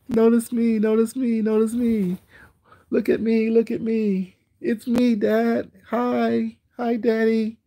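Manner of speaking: high whiny plea for attention; hammed-up attention-seeking child voice; mock needy kid. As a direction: beg to be noticed like a clingy child.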